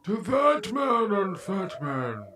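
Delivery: theatrical villain introducing a show; Bane. Acted. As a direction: drag each phrase out with menacing ceremony. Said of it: "I'm Bane, goddammit".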